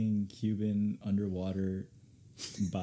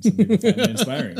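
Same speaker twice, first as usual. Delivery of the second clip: high, loud laughing line; 12 semitones higher, 14 dB louder, faster than usual. Real, not acted. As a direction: laugh the words out. Laughing through his own joke.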